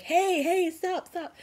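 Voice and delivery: high, loud shout to passing cars; calling out. Retold or imitated. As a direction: imitate flagging down a car. Helpers flag down passing cars.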